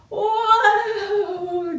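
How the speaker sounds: high, loud drawn-out call to the horse; loud whoa call. Shown as a demonstration. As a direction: haul on the reins and call out. Pulling reins, calling to horse.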